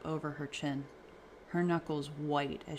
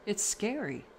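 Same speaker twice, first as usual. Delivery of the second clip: put-on scared child; 6 semitones higher than usual. A narrator's reading. Child under blankets, frightened.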